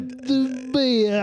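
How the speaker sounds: high, hammed-up Cockney; Cockney accent. Mocking a poor Brit fetching beer, Cockney accent. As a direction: put on a thick Cockney voice. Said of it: "There's a Cockney accent".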